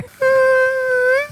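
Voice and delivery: high buzzing whine; mosquito whine. Retold or imitated. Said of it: "Gailey's mosquito impression".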